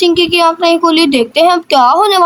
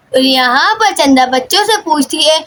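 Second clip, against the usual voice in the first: ordinary delivery, nothing marked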